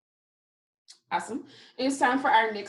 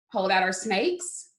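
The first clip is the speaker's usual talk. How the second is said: usual speaking voice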